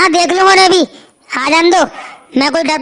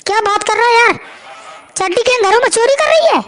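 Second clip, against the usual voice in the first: shocked; 5 semitones higher than usual. Acted. Friend hearing of the underwear gang, high shocked disbelief. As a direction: voice jumps up in disbelief.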